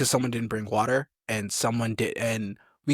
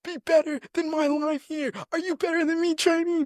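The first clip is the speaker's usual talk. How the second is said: high barking drill sergeant voice, well above his own; mock drill sergeant yell; imitating drill sergeant. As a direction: bark it like a drill instructor mocking a recruit. Drill sergeant yelling at recruits.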